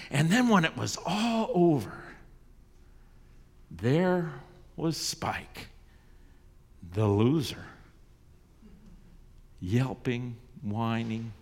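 usual speaking voice

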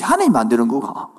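usual speaking voice

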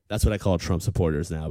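usual speaking voice

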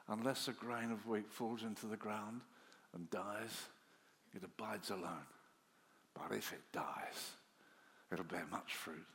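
Jesus' answer, low and soft; a low, soft Jesus voice answering the Greeks; gentle and low. Acted. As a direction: ease it out quietly like a tender promise.

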